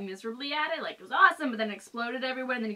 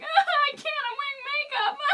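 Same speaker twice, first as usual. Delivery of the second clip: mock crying; 3 dB louder, faster than usual. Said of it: "and I'm like".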